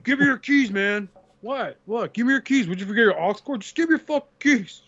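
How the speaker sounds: excited dude voice. Retold or imitated. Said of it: "they're like".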